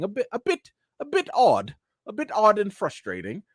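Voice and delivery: a bad British accent, high and hesitant; a clumsy try at a British accent; imitating Haley Atwell in a weak British accent. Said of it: "that was my British accent, not too good".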